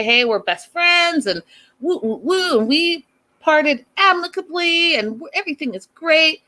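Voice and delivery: high, singsong fake enthusiasm; mock cheerful. Host mocking exes who claim friendship, mock cheerful. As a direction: parody people gushing about a friendly breakup.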